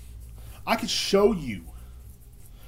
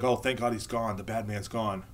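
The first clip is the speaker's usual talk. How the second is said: imitated relief; 5 semitones lower, 6 dB quieter than usual. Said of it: "everybody be like".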